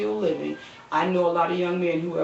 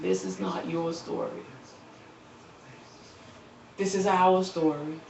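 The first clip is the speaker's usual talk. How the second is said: softer, choked-up quiet words; emotional; 2 semitones lower, 9 dB quieter than usual. Real, not acted. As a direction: hold back tears as you say it. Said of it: "I'm getting emotional right now".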